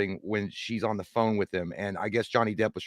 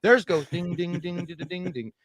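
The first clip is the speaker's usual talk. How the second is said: mock [name]; 6 semitones higher than usual. [name] on the other song, high singsong fading. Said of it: "like [name] explaining the difference".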